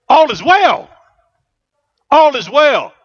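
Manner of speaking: preaching at full force. Real, not acted. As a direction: lift the church with it. Preacher builds to a climax.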